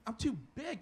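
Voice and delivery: normal talking voice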